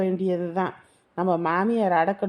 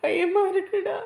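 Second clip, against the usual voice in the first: higher, slow, crying with fear; scared sobbing; 9 semitones higher, slower than usual. Acted. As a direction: whimper it out. Girl frightened, weeping. (பயத்துடன் அழுகை)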